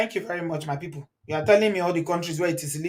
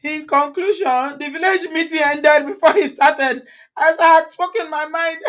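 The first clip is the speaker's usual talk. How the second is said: amused reading; 7 semitones higher, 6 dB louder than usual. Real, not acted. Reading a funny comment, laughing.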